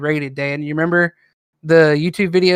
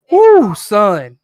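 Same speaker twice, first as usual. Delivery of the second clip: louder country exclamation; country accent; 3 semitones higher, 6 dB louder than usual. Shown as a demonstration. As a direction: whoop it with a Southern twang. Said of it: "when I'm around my parents".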